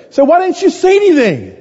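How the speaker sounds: very high-pitched exasperated dad, retold; exasperated disbelief. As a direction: demand an explanation in a squeaky-high retold protest. Retells dad's reaction to son's admission.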